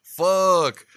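high, loud shocked curse. Retold or imitated. Son seeing his mom knocked over, a loud shocked curse. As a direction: blurt it out in alarm. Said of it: "I was like".

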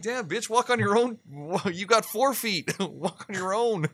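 high, laughing scold at the dog. Retold or imitated. Owner scolding the heavy dog while laughing, in a high voice. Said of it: "I'm like".

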